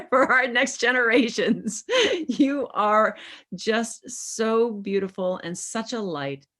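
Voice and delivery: overjoyed laughing gush; gushing with laughter. Real, not acted. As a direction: burst into happy laughing praise. Host's gushing farewell to guest.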